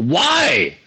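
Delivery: drawn-out, high-swooping mock yell. Ticket seller to couples buying Little Women, mock exasperated yell. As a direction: imitate yelling in exasperation without real volume. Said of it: "I would yell".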